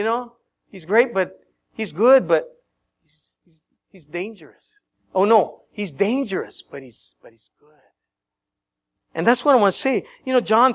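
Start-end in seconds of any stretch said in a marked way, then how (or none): 3.0-4.7 hushed warning. Acted. Dramatic point about C S Lewis. 6.6-7.7 hushed. Acted. Dramatic point about C S Lewis.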